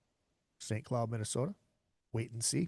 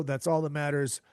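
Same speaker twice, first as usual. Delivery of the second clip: ordinary talk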